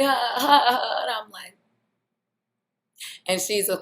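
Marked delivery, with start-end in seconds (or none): 0.0-1.0 forced mock laugh, higher and louder; mocking the girlfriend's forced laugh; fake laugh. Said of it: "she's like".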